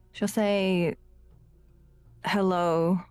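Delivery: ordinary delivery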